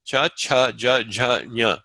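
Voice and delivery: Cha, cha, ja, ja, nya spoken as clean model syllables; palatal drill. Shown as a demonstration. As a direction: sound out each palatal for the class. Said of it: "tongue going against the soft palate".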